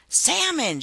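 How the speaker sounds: high, loud delighted cry; joyful exclamation. A narrator's reading. Harriet spotting fish in the river, joyful cry. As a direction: call it out with joy. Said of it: "she joyfully declared".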